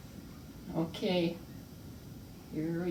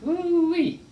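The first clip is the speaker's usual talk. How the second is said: excited whoop; 11 semitones higher, 6 dB louder than usual. Real, not acted. Tasting fresh-baked bread.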